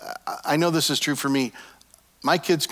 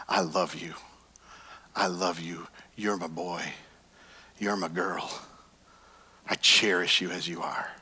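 tender God voice; 8 semitones lower, 7 dB quieter than usual. Pretend. God speaking love over each listener, soft and tender. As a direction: lower and much softer, intimate. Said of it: "hear your very words".

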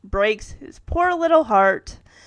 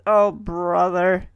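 slow, lower eye-roll delivery; mock exasperation; 5 semitones lower, much slower than usual. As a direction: drag it out, thoroughly unimpressed. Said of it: "rolling her eyes as she says".

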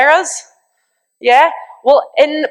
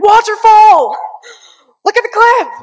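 much higher and louder thrilled yell; excited shouting; 10 semitones higher, 5 dB louder than usual. Retold or imitated. Friends in the truck spotting a waterfall, thrilled shouting. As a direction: yell it out like a fan at a match. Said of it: "We'd be like".

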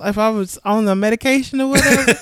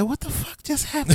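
high, hushed bewilderment; hushed and baffled, pitched up; confused retold disbelief; 6 semitones higher, 7 dB quieter than usual. Baffled after hanging up the phone, hushed disbelief. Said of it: "He was confused".

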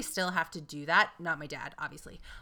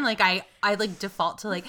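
ordinary talk

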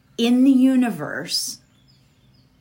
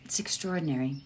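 ordinary talk, nothing marked

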